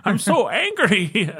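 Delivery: high, slow mock-angry leprechaun voice; leprechaun Peterson. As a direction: squeak out fake rage like a leprechaun. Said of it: "my favorite new character".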